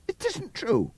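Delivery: high, pleading mare's voice; Molly's high denial. A narrator's reading. Molly repeating her denial to Clover. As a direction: insist it shakily high. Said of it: "repeated Molly".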